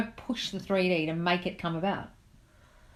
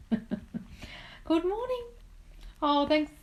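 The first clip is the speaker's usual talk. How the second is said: singsong greeting; 10 semitones higher, much slower than usual. Real, not acted. Greeting viewers as live starts.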